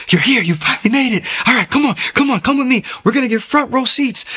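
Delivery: high-pitched, excited welcome, hurrying a friend along. Retold or imitated. Excited friend welcomes a newcomer to church, thrilled. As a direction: imitate an overexcited friend greeting you. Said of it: "this guy was so excited".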